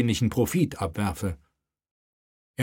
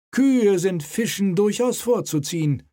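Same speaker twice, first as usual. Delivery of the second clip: raised, self-satisfied voice; smug inspector; 11 semitones higher than usual. Acted. Dutch inspector flatters the king, smug. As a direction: agree with oily satisfaction. Harlem agrees smugly.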